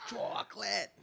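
high cartoon catchphrase voice; cartoon quote. Acting out a SpongeBob screaming quote.

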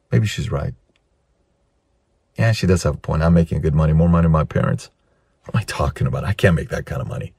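low, soft self-talk; self-doubting man. Acted. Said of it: "He says".

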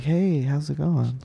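soft, slow, breathy-free quiet come-on; flirty voice. Shown as a demonstration. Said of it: "I go ho voice on people a lot".

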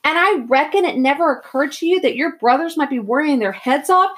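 loud, high, exasperated tirade; heated rant, building to a high yell; angry scolding. A narrator's reading. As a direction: tear into him as a worried, furious brother. Older brother scolds him angrily.

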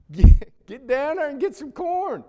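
put-on high, loud, laughing old father; chuckling father hurrying his boys along; Jacob urging sons. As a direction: play Jacob shooing his sons off for corn. Preacher voicing Jacob to sons.

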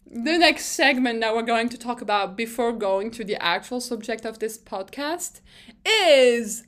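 ordinary talk

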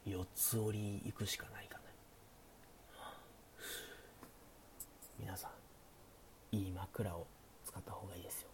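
hushed late-night chat, often whispered; sleepy. Pretend. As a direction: talk softly like the listener is drifting off. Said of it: "寝落ち配信なんでね". (小声でささやく寝落ち配信トーク)

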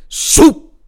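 high, loud swoosh of a flying hat; swoop. Retold or imitated. Hat flies off sound.

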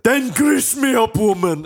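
high, loud, slow Scottish bellow; Willie. Acted. Groundskeeper ordering lunch lady, bellowed Scottish. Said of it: "he goes to the lunch lady".

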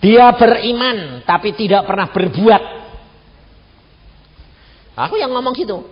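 a hint of God's stern reply; God answering. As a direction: speak as God giving a firm verdict. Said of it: "Tuhan, dia bilang apa?".

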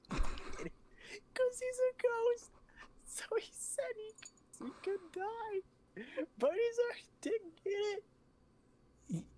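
high, slow laughing through words; can barely get it out for laughing; laughing falsetto. Real, not acted. Storyteller explaining his own pun, high, slow and laughing. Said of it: "Classic, [name]".

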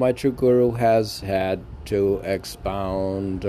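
lifted, measured poem reading; poem recital. Acted. Reciting his poem on the podcast, measured verse voice.

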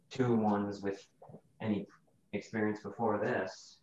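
ordinary talk

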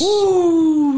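loud, high cheer; excited whoop. Real, not acted. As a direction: whoop it up for the card. Hyping the big fight card.

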